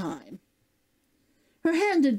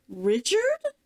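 surprised; 9 semitones higher than usual. Acted. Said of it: "she asked stupidly".

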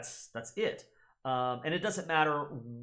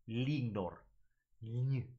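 normal talking voice

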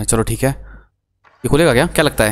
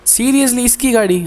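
surprised; 11 semitones higher, slower than usual. Real, not acted. Recognizing whose car to steal.

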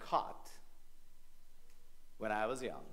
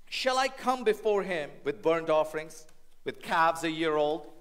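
sarcastic; 6 semitones higher, 7 dB louder than usual. Acted. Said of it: "is the lawyer being sarcastic?".

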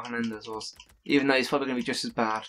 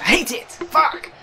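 high, loud furious shout; angry outburst; 8 dB louder, slower than usual. Real, not acted. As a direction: explode at the game. Panicking over missed chance.

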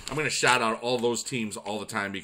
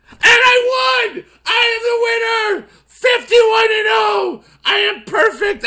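very high, loud, drawn-out victory yell; triumphant shouting; 11 dB louder, slower than usual. Real, not acted. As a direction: scream each phrase slowly like a champion. Shouts on winning with perfect record.

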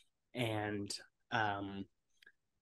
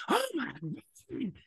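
much higher, slow, drawn-out gasp of shock; shocked nurse; much slower than usual. Retold or imitated. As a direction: nurse seeing the split forehead. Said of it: "the nurse is like".

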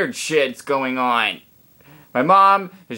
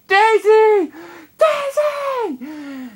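screaming for the dog; much slower than usual. Retold or imitated. Calling the runaway dog down the street, high yell. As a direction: holler after a runaway dog. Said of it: "and I'm screaming".

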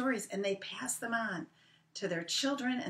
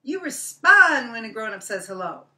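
louder lecturing rabbit; indignant Brer Rabbit; 4 semitones higher, 11 dB louder than usual. Acted. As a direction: scold like an offended grown-up. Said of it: "he said".

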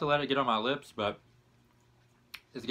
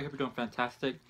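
ordinary delivery

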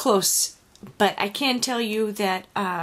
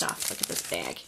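whispered; 12 dB quieter than usual. Real, not acted. Showing off gift bag.

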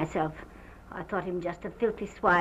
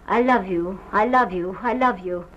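loud, urgent love declaration, retold; imitating a man's pressing avowal; insistent repeated declaration; 7 dB louder than usual. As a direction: repeat it insistently, each one forceful, as he did. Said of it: "He repeated it just like that".

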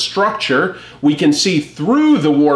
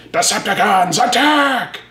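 high, hammed-up attack order; battle cry; 6 semitones higher than usual. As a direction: call the charge like a cartoon villain. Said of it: "we instantly hear".

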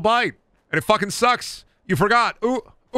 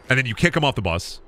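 ordinary talk

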